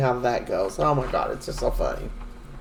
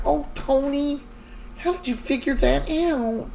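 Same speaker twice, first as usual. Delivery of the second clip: high-pitched imitated fluster; retold high, anxious plea; mock pleading; 9 semitones higher, 4 dB quieter than usual. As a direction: beg him to keep the secret, pitched up. Retold Anna begs him for secrecy.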